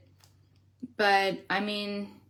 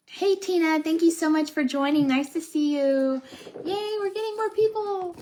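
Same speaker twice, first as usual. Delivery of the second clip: high, bright welcome to a new viewer; excited greeting; 10 semitones higher, 4 dB louder than usual. Real, not acted. Streamer greets a viewer joining the live, excited. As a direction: greet the newcomer with a lift.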